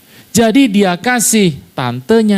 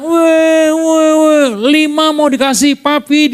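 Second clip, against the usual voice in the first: put-on child yelling; 11 semitones higher than usual. Son yelling to dad about five free puppies, high excited shout. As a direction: shout it like a thrilled kid. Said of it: "anak saya teriak".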